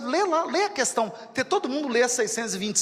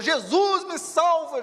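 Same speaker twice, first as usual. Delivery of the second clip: high, acted desperate plea; cry for help; 6 semitones higher, 3 dB louder, slower than usual. Teresa drowning in the river begs Jesus, imitated cry for help. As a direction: call out loudly as if going under.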